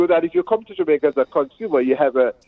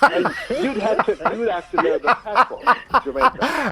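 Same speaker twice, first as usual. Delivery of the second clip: higher, slow, laughing through words; laughing tease; 7 semitones higher, slower than usual. Real, not acted. Teasing the English host about patois, laughing. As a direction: tease him while chuckling.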